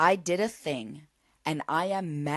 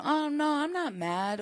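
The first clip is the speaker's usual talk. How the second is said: mock denial; 10 semitones higher than usual. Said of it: "tendency to deny and pretend".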